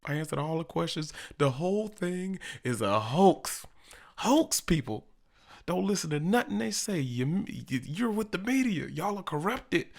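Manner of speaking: high-pitched mock tirade at the media; comic high-voiced denial and accusation; mimicked Trump. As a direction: imitate an indignant politician, voice pushed way up. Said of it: "He was like".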